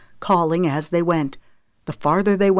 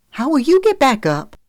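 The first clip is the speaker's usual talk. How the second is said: high, louder, anxious question; worried mother; 9 semitones higher, 5 dB louder than usual. A narrator's reading. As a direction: press him in a worried woman's voice. Mother worrying over rescue.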